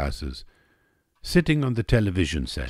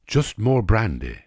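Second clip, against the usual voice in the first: ordinary delivery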